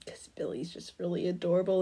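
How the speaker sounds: soft whisper of delight. Real, not acted. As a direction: whisper it like a secret. Cooing over cute pattern.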